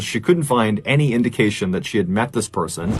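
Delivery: usual speaking voice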